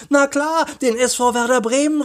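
put-on very high, eager boy's exclamation; excited call. A narrator's reading. Boy names his football club, excited call. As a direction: blurt it out high with enthusiasm. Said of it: "rief Peter".

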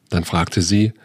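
ordinary delivery

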